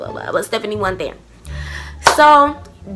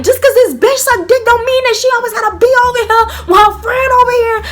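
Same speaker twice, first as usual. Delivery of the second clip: going off; 10 semitones higher than usual. Retold or imitated. Cursing out Stephanie in the room, high heated rant. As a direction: spit it at her in full rant. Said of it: "And I'm just going off".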